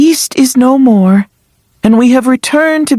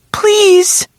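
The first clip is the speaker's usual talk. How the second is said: tearful; 5 semitones higher than usual. Acted. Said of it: "You're crying".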